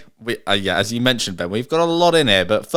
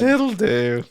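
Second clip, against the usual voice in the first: sing-song echo; 11 semitones higher than usual. Retold or imitated. Host echoes sign-off.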